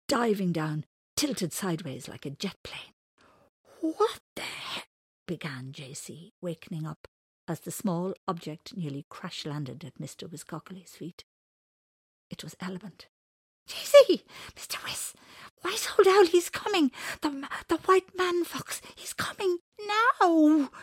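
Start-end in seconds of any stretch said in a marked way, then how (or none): 3.8-4.8 startled, high, cut off mid-word; startled exclamation. Acted. Startled by crash landing. 13.7-20.7 high, loud, panicked and gasping; frightened and breathless. Acted. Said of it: "wide-eyed with fear, out of breath and gasping".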